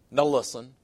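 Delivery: lightly imitating a southern preacher; Charles Stanley impression. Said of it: "that's how Charles Stanley always says it".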